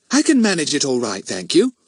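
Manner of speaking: a little high and huffy. A narrator's reading. Girl answers her friend's offer to take over, huffy. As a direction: put out, insisting you can cope. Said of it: "she said huffily".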